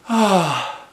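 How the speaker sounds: loud, high relieved "ah"; sigh of relief. A narrator's reading. Girl can breathe again, sigh of relief. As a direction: let out a big falling sigh.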